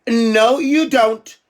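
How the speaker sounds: normal talking voice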